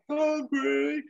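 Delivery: very high weird baddie cry; screechy-high quote of a film villain; Maureen Lipman. Household quoting a favourite baddie, high mock villain cry. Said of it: "we're always quoting Maureen Lipman".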